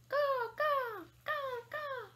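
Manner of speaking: high, loud falling caws; imitating a crow's cry; crow call. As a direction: caw out like a crow. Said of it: "You ever heard a crow?".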